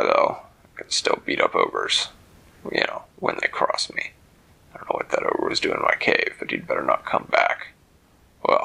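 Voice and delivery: put-on low, gruff old dragon; deep dragon voice. A narrator's reading. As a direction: rumble like a big old dragon. Dragon Hermes talks.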